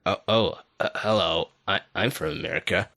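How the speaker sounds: put-on a British guy doing an American accent on request. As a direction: put on a Yank voice to show it off. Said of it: "American voice".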